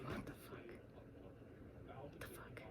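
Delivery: hushed disbelieving whisper. Real, not acted. Marvelling to viewers about her idol's house, whispered. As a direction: breathe it out in disbelief.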